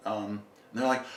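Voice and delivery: usual speaking voice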